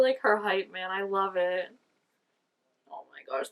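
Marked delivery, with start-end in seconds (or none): 2.9-3.5 whispered gush. Real, not acted. Gushing over cute couple.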